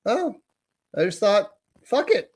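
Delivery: put-on high-pitched little-kid voice; matter-of-fact child. Daughter explains herself to her mother, high childlike voice. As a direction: imitate a small child explaining, pitch climbing.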